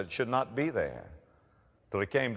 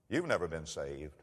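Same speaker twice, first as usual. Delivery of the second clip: quiet drop; 6 semitones lower, 7 dB quieter than usual. Acted. Drop after loud build.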